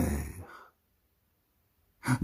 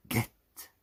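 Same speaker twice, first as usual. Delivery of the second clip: a sharp whisper; whispered urging; 5 dB quieter than usual. Acted. Reader urging the listener to watch, whispered. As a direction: breathe it as a secret warning.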